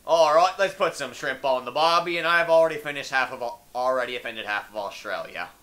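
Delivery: put-on light Australian accent; mock Australian. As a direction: taunt them in a hammed-up Aussie voice.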